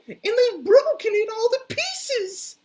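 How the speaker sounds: very high, loud squeaky cry; crying Baby Bear. Acted. Baby Bear finds his chair broken, very high loud cry. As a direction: wail it like a tiny upset cub. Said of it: "he cried".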